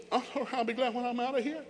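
imitating a daughter's high-pitched retort; mock teenage complaint, way up high; put-on high voice, daughter talking back; voice up real high. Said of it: "heard her voice go up real high".

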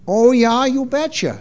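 broad, folksy Minnesota lilt; Minnesota accent. Acted. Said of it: "as they say in Minnesota".

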